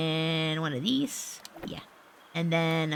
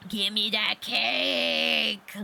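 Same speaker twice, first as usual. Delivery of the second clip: slow, drawn-out put-on voice; greedy demand; much slower than usual. Player demanding the chocolate cake, playfully greedy. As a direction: stretch it out, hungrily hammy.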